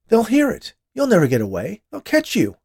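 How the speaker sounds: high, loud and frantic; urgent, frightened shouting; desperate warning. A narrator's reading. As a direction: warn them off in panic. Desperate warning to would-be killer.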